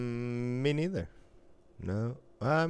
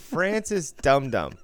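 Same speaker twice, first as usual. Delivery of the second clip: drawn-out, louder mock name call; mock announcing; 6 semitones higher, 7 dB louder, slower than usual. Friend mocking a silly name, drawn out. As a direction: savour the silly name slowly.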